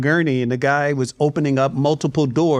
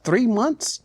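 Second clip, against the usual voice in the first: incredulous; 6 semitones higher than usual. Retold or imitated. Mocking a toxic actor.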